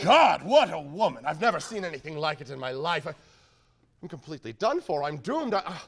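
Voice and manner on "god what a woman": loud, slow, awestruck; smitten exclamation. Acted. Suitor overwhelmed by the woman, loud awed exclamation. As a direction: marvel at her out loud.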